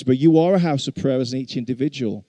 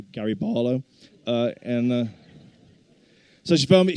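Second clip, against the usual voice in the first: ordinary talk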